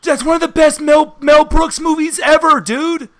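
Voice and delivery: loud, high-pitched enthusiasm; excited. Real, not acted. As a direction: gush loudly about a favourite film. Gushing over a favourite film.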